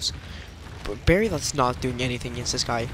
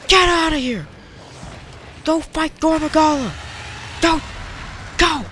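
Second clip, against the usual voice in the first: higher, louder frustrated yell; angry yelling; 11 semitones higher, 4 dB louder than usual. Real, not acted. A gamer yells at his AI partner in real anger. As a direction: bark at a useless teammate.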